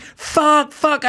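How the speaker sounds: high-pitched screamed cursing; screaming. Retold or imitated. Crashed driver cursing over his wrecked car, screaming. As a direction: yelp the curse out, high and strained. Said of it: "I started screaming".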